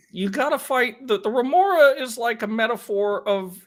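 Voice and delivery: strained, high-pitched parody voice; Jordan Peterson. Acted. Passing it off as a remora impression, Jordan Peterson voice. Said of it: "it's just a Jordan Peterson every time".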